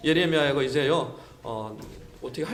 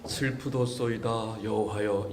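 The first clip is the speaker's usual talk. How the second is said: slow, much lower, softer, stately archaic lament; mock solemn prayer; 10 semitones lower, 8 dB quieter, slower than usual. Shown as a demonstration. Preacher showing the wrong calm way to answer God, mock solemn. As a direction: intone "슬프도소이다" slowly and low like a stiff liturgy. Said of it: "이러지 않았어요".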